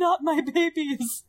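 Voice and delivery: high, slow, hammed-up wail; mock crying. Co-host mocking Magneto over his dead family, mock crying. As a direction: wail it like an overacted grieving father. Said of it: "He's crying".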